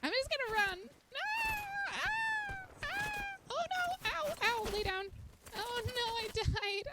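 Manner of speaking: high-pitched panicked squeal; panicked screaming. Real, not acted. Player running from attack in a game, panicked screaming. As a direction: squeal in alarm while fleeing.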